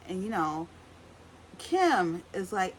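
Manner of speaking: ordinary talk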